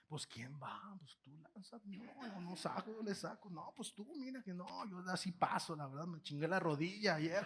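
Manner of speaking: mumble, passing the job to the next man; nervous murmuring. Retold or imitated. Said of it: "todos murmuraban".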